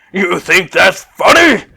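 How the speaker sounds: irritated rival; annoyed retort. Acted. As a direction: challenge her taunt. Sissel retorts mid-race.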